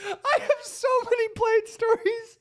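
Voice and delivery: high, wheezy words through laughter; real helpless laughter. Real, not acted. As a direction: barely get it out for laughing.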